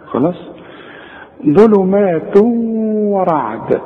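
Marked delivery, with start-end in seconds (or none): 1.5-3.8 ظلمات ورعد recited very slowly with ghunna on و; slow recitation. Shown as a demonstration. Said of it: "عايزك ترقق بقى الواو". (ظلمات ورعد تلاوة بطيئة بغنة)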